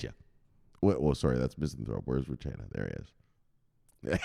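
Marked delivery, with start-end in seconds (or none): none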